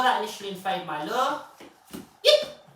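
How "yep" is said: very high, loud chirp; short high yip to mark the trick; marker word. Real, not acted. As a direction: chirp it to the dog.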